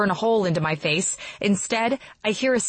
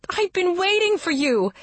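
shouting; 11 semitones higher than usual. A narrator's reading. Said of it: "she shouts".

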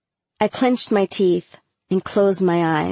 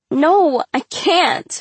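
high, upset wail; crying out; 9 semitones higher, 4 dB louder, much slower than usual. A narrator's reading. Humiliated girl refusing to leave the stall, crying out. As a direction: cry it out in distress. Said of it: "she cried".

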